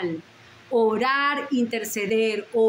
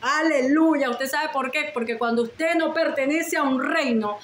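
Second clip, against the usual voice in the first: ordinary talk, nothing marked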